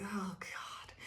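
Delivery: a whispered sigh of giving up; exasperated whisper. Retold or imitated. Person fed up with thinking of you, whispered. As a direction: breathe it out as a weary whisper. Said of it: "they're just like".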